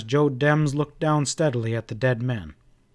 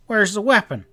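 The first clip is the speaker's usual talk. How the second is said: a sudden higher, sharp demand; explosive demand; 8 semitones higher, 3 dB louder than usual. A narrator's reading. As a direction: fire the question out explosively. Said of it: "Dems demanded explosively".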